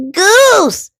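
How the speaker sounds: high, sharp squawk. A narrator's reading. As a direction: squawk the name out. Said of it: "squawked Duck".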